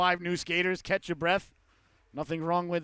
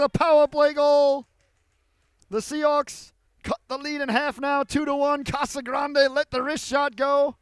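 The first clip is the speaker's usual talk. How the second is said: shouted, much higher and louder goal call; 8 semitones higher, 7 dB louder than usual. Real, not acted. Play-by-play announcer calling the Seahawks power play goal, shouting. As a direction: explode on the score and keep riding it.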